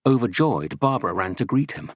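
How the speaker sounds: ordinary delivery, nothing marked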